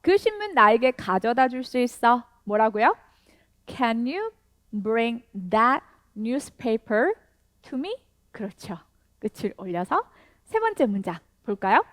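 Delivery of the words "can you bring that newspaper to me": very slow, each word spaced out; slow teacher demo with a rising question end; slow model sentence. Shown as a demonstration. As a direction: model it slowly for learners to repeat. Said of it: "다시 한 번 더".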